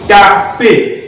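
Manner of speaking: slow split reading of "taper"; syllable by syllable. Shown as a demonstration. Teacher forming a word from syllables, slowly sounded out.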